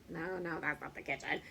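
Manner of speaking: low-pitched mock denial; playful denial. Shown as a demonstration. Teacher models the no answer for kids, playful low voice.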